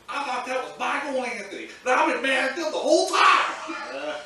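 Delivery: high, loud indignant grandmother; loud, high mock-furious grandmother; grandma's outrage. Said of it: "And she goes".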